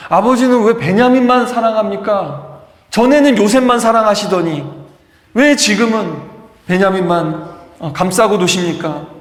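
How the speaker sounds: loud, high reproach, easing toward the end; aggrieved protest. Acted. Jealous brothers confronting their father over Benjamin, acted aggrieved protest.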